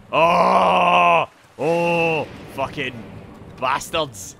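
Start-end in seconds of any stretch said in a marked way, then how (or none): 0.1-2.3 long, loud cry of alarm; raised, sustained shout of dismay; loud drawn-out yell. Real, not acted. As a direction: holler two sustained ohs. Cries out in game fight.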